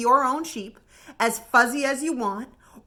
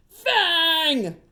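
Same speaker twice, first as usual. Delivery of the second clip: higher call of the name; yelled name; 5 semitones higher than usual. Acted. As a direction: shout for the dog across the room. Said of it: "yelled Bungo".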